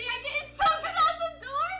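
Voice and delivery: high, loud indignant complaint; mock outraged yell. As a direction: cry out at being picked on. Complaining about being insulted.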